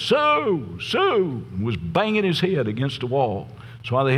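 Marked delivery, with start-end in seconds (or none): 0.0-1.5 high-pitched drawn-out wail, falling away at the end; mock scream. Man in the padded cell crying out the name Sue, high mock scream. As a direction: imitate a screamer without full volume. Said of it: "screaming at the top of his voice".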